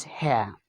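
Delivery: here with the R dropped; British-style here without the R; non-rhotic here. Shown as a demonstration. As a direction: end here on a vowel, no R. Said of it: "non-rhotic speakers will pronounce this word as".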